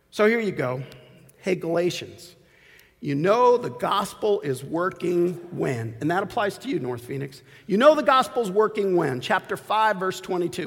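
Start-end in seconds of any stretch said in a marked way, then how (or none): none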